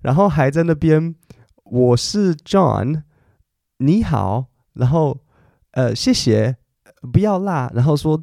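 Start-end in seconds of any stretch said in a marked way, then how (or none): 1.6-7.7 very slow, halting beginner Mandarin; a lightly voiced foreign learner; beginner foreigner speaking Mandarin. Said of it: "他才刚学一个月".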